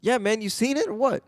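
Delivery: high, pushy question; lightly voiced Keenen pressing; playing Keenen. Keenen pressing Seagal about Casablanca, high and pushy. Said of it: "You be Keenen Ivory".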